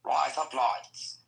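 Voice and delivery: slow, drawn-out "Rise up, lights" passing for Australian "razor blades"; Australian-sounding trick phrase. Shown as a demonstration. Repeating the trick phrase on request, slow Australian-style delivery. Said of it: "do you know how to speak Australian".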